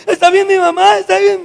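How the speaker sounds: put-on high, loud pleading child voice; anxious child. Ten-year-old asks the coach if his mom is okay, anxious. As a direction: voice a worried boy begging for reassurance.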